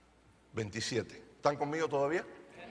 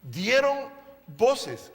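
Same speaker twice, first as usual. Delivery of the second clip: dieron voces stretched very slowly; drawn-out emphasis; much slower than usual. Shown as a demonstration. Preacher lingering on dieron voces, very slowly. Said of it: "Fíjense, estos son hombres".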